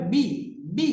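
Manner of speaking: বি said short, without stretching; quick short vowel. Shown as a demonstration. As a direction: keep the vowel of বি brief. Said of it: "হারাকার উচ্চারণ তাড়াতাড়ি হয়". (তাড়াতাড়ি, টান ছাড়া বি)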